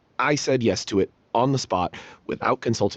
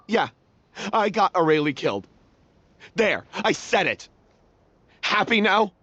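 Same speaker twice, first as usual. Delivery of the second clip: rising higher to a defiant happy now; bitter confession; 9 semitones higher than usual. Acted. Admitting he got Aurelie killed, higher and bitter. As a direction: blurt the guilt and throw it back at them.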